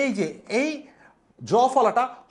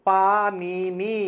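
Panini drawn out very slowly; slow model word; 2 semitones lower, much slower than usual. Shown as a demonstration. Teacher gives the correct spelling, slowly. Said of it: "বানানটা হবে".

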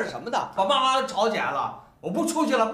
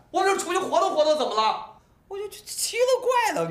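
exasperated protest; 12 semitones higher than usual. Acted. Husband to wife and parents, exasperated high protest. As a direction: throw up your hands, what's wrong with going out.

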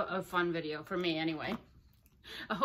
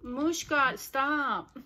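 louder, higher command; real raised-voice telling-off of a pet; scolding the dog; 4 semitones higher, 6 dB louder, much slower than usual. Real, not acted. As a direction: call the dog off sharply. Scolds dog mid-unboxing.

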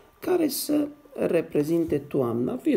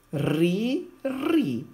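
"Ri, ri" punched louder, second much higher; emphatic syllable demo; 7 semitones higher, 3 dB louder than usual. Shown as a demonstration. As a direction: call out "ri" to the class, rising. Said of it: "R-I se citește ri". („ri” rostit apăsat, tot mai sus)

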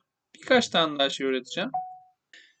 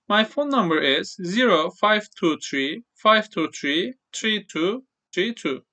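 digits one by one; slower than usual. Shown as a demonstration. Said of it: "tek tek okuyorsunuz".

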